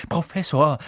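high, loud urgent outburst; emphatic, pressing appeal; throwing caution away. A narrator's reading. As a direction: blurt out the secret. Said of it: "ließ alle Vorsicht fahren".

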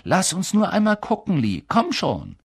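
lightly acted high-pitched begging; a touch of impatient urging; eager pleading. A narrator's reading. Children begging to see inside box.

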